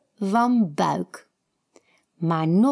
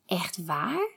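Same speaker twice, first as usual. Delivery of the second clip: hushed, softer astonished question; soft amazement; 7 dB quieter than usual. A narrator's reading. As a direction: breathe it in wonder. Kiki amazed, softly.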